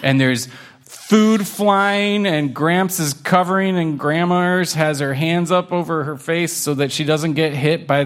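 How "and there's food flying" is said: ordinary delivery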